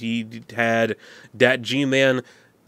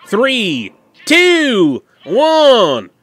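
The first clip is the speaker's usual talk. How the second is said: shouted countdown, much higher and louder; 10 semitones higher, 9 dB louder, much slower than usual. Real, not acted. Game maker calls the countdown, loud and high. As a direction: belt out each number.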